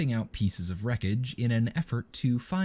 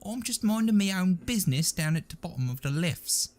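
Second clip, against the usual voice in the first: high, nervous trooper voice; panicked whine; 10 semitones higher than usual. A narrator's reading. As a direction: make excuses in a panic. Nubby's panicked excuse continues.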